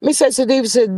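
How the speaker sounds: ordinary talk, nothing marked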